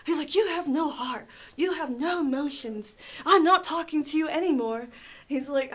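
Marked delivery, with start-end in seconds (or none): 0.3-4.9 higher, emphatic retold accusations; mock outrage, hitting each accusation hard; mimicked real anger. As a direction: imitate a customer who is really mad. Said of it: "he got really mad".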